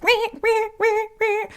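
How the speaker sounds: high, squeaky fake wailing; mock baby wail. People hiding behind smiles, mock baby wail. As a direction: whine like a crying baby underneath the smiles. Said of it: "they're like".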